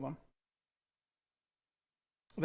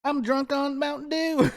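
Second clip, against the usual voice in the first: giggling through the words; laughing; 8 semitones higher, 5 dB louder than usual. Real, not acted. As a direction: laugh it out, high and slow. Laughing joke.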